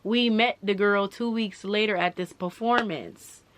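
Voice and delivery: normal talking voice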